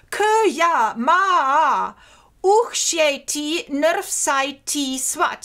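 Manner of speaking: slow, loud, each syllable of "Kya ma uchsheti" pressed out; syllable-counted reading. Shown as a demonstration. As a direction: pause after "Kya ma", then seven even syllables. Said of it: "So four plus seven syllables".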